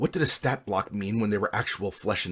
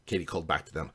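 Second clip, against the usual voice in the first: ordinary delivery, nothing marked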